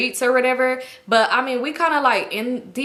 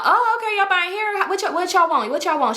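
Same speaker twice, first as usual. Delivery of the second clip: high, sassy mock surprise; imitating a girl jumping on them, pitched way up; sarcastic attitude; 8 semitones higher than usual. Girl mocking the newcomers.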